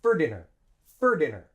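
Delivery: "For dinner" as "ferdinner"; short "fer" run straight into "dinner"; linked like one word. Shown as a demonstration. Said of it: "say it like one word".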